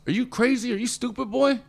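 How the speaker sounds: hammed-up boss losing patience; incredulous boss. Imitating the station boss yelling at young Les Brown. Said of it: "Third day in a row".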